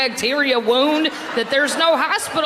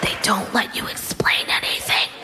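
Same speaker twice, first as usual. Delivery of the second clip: a hushed whisper, like a conscience; whispered inner voice; 4 semitones higher than usual. Retold or imitated. The comic's inner voice warns him about the quiz, whispering. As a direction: whisper it like a secret nagging voice.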